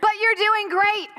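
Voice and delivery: high loud cheer easing toward the end; yelling parent. Retold or imitated. Parent urging on a tired son, high yelling that eases. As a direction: yell encouragement to a tired runner, then ease off. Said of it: "yelling like the loudest, most embarrassing parent".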